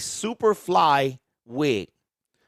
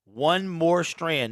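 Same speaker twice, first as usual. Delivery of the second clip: very slow, stretched words; slow and drawn out; 2 semitones higher, much slower than usual. Shown as a demonstration. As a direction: draw each word out slowly. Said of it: "repeats the donor's message slowly".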